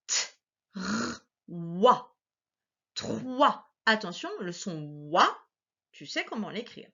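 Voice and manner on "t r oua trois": TROIS split into T, R, WA, then blended; sounded out. Shown as a demonstration. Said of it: "c'est la syllabe".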